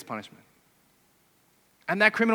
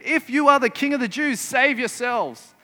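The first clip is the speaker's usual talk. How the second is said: higher, louder mock taunt; 6 semitones higher, 6 dB louder than usual. Preacher acting the soldiers taunting Jesus, mocking. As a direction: taunt him like a jeering soldier. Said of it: "The soldiers mock him".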